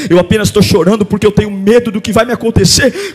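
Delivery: quiet ministry. Acted. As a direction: draw them in gently to repent. Quiet altar-call ministry.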